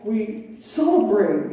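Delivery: normal talking voice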